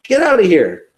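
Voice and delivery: high-pitched hammed-up hostility; mock attack. Chasing Jehovah's Witnesses from the door, mock angry. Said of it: "getting your stones out and saying".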